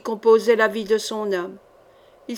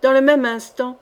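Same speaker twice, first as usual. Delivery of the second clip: usual speaking voice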